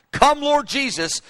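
impassioned cry of come. Retold or imitated. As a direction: cry it out as a plea. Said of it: "cry out".

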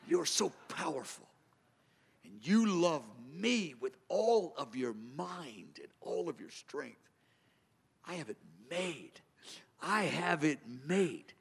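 low, slow, hushed words of praise; soft prayer. Shown as a demonstration. Preacher demonstrating intimate prayer under the stars. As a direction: murmur it with quiet wonder. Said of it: "Just feels good to say that".

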